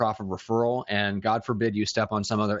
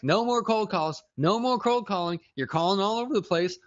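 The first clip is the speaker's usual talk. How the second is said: higher, sarcastic parody of the caller; mock him in a higher voice; 9 semitones higher than usual. Cohost parodies the angry caller's demand, mocking higher voice.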